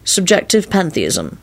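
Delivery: measured musing, with pauses; thoughtful. A narrator's reading. Lupin working through his literary answer, thoughtfully. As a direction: think aloud, weighing each term. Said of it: "he replied thoughtfully".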